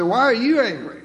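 emphatic demand. Shown as a demonstration. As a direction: fire the question back at him. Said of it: "I think he may have said it this way".

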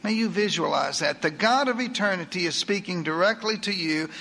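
usual speaking voice

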